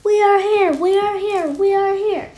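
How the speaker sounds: very high crowd cry; high, loud, repeated chant; crying loudly. A narrator's reading. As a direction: shout it as a tiny chanting crowd. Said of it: "People cried loudly".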